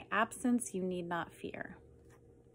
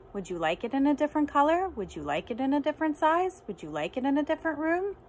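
frantic offers; 5 semitones higher, 4 dB louder, faster than usual. Acted. Cat offering fixes frantically.

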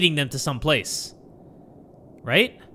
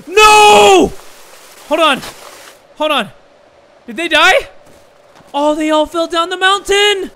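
high, loud disbelief; gasping, high-pitched yells; shocked shouting; 12 semitones higher, 9 dB louder, slower than usual. Real, not acted. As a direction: cry out in horror at a disaster. Shocked at deer falling.

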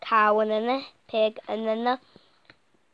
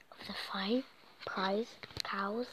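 whisper; 2 semitones lower, 11 dB quieter, slower than usual. Acted. Whispered word in story.

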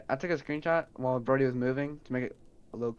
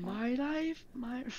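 high sassy voice; high diva catchphrase; 11 semitones higher, 5 dB quieter, much slower than usual. Pretend. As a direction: sing it out like a diva. Catchphrase again.

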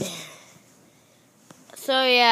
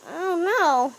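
ordinary talk

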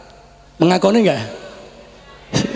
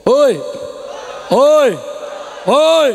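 calling out; much slower than usual. Real, not acted. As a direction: shout hoi to get attention. Preacher calls out to crowd.

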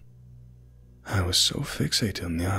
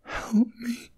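put-on a high, faint child's plea; barely able to ask for help; frail little girl begging; weak girl. A narrator's reading. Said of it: "She sounded weak".

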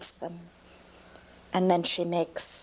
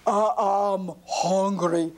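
slow, louder giant line; a big slow giant admitting hunger; 4 dB louder, slower than usual. Acted. Giant says he is hungry.

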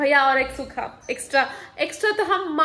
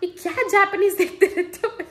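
higher-pitched mock of a scolding father; teasing imitation of her father, higher and louder; mocking dad; 7 semitones higher, 4 dB louder than usual. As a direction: play up dad's exasperated complaint. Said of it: "पापा बोल रहे हैं".

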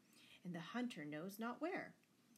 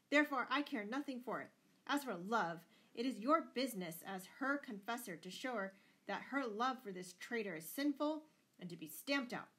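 loud, higher-pitched blustering lord; shouted; 4 semitones higher, 7 dB louder than usual. A narrator's reading. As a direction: keep arguing at a raised, forceful pitch. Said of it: "shouted Lord Fitzwalter".

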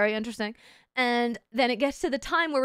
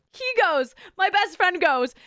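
ordinary delivery

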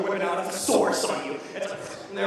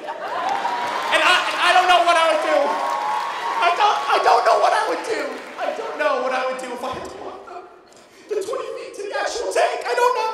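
shouted, much higher mock despair; mock panic; 11 semitones higher, 7 dB louder than usual. Comedian to the crowd, hammed-up panicked shout. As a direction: yell it like the tank is unbearable, laughing.

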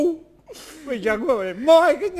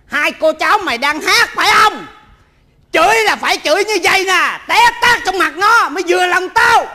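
loud, higher scolding; angry rant; 7 semitones higher, 6 dB louder than usual. Acted. Old man scolding them for weak cursing, shouting.

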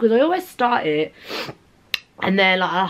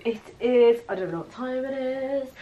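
normal talking voice

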